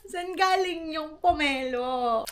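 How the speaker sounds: high, slow laughing wail; laughing disbelief. Real, not acted. (natatawang parang naiiyak)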